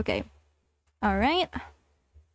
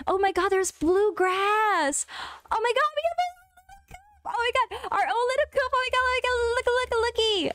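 excited squeal; 10 semitones higher than usual. Real, not acted. Squealing over a cute farm coop.

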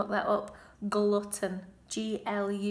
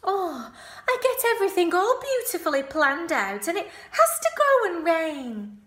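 high, loud whiny pig voice; Wilbur's lament; 9 semitones higher, 7 dB louder than usual. A narrator's reading. Piglet complaining to himself about the rain. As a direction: wail it out like a disappointed piglet. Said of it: "he said".

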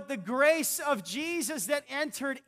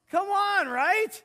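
excited; 7 semitones higher, 5 dB louder, slower than usual. Real, not acted. Preacher excited about lotto win.

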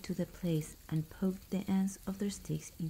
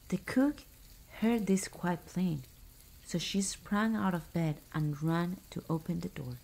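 plain reading voice

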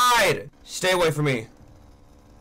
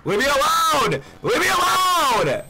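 desperate shout; 9 semitones higher, slower than usual. Real, not acted. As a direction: scream it to make the monster back off. Yelling at chasing monster.

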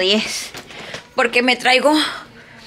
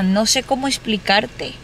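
ordinary talk, nothing marked

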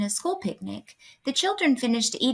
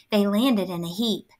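ordinary delivery

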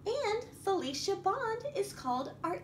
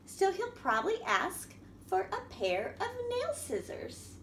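lively picture-book reading voice; reading to children; 3 semitones higher than usual. A narrator's reading. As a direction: read it out to a room of little kids.

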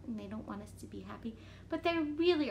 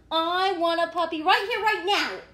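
loud, higher insistent demand; demanding pigeon; 5 semitones higher, 9 dB louder than usual. Acted. Pigeon demanding a puppy from the reader, loud tantrum.

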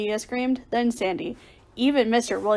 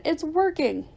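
high-pitched happy exclamation; cheering; 6 semitones higher than usual. A narrator's reading. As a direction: exclaim that the plan works. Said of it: "I cheered".

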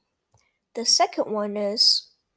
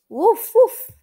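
loud high yappy puppy woof; puppy barks; 9 semitones higher, 9 dB louder than usual. Acted. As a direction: bark like an eager little puppy. Voicing the puppy Biscuit.

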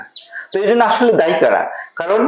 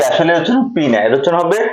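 ordinary talk

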